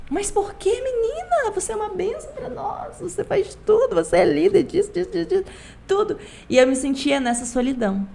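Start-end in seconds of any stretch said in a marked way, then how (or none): 0.1-6.2 high, lightly voiced patronizing elder; mock the leaders brushing her off, pitched up high; imitating church leaders. Voicing church leaders' reassurance.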